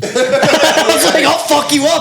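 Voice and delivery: high, loud laughing; laughing hard. Real, not acted. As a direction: laugh through it.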